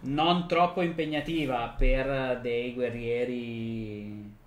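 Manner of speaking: usual speaking voice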